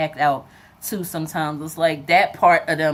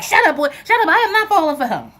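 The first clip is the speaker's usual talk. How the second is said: loud, high mock denial; playful snap back; 10 semitones higher, 8 dB louder, faster than usual. Mother loudly denying she's falling for him to her son. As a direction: shout him down, half laughing it off.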